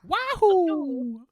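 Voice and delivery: high, loud video-game whoop; Mario. Acted. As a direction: let out a long, high cheer. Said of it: "only doing Western and Mario impressions".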